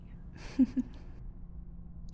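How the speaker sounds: long whispered chuckle; breathy villain laugh. Acted. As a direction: laugh at the thought of the blade. Villain mocking captive.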